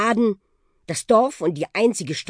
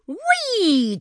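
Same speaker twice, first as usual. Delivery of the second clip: high, stretched swooping whee; playful high flying cry; gleeful whee. Acted. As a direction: whoop as you dive through the air. Bus swoops under power line.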